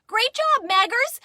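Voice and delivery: high, loud praise; excited cheer. Acted. Big brother praises his sister while racing, excited cheer. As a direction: cheer the little one on.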